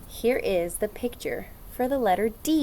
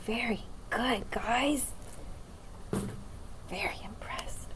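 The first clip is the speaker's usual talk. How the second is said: whisper; 11 dB quieter than usual. Acted. Whispered praise to class.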